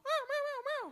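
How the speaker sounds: high mock kitten meows; kitten meowing back. Kitten answering sister, mock high meowing. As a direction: meow like a tiny kitten. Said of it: "it's gonna go".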